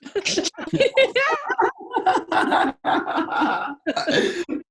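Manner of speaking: high helpless laughter; loud high giggling fit; burst of laughter. Real, not acted. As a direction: crack up out loud. Laughing at the shout.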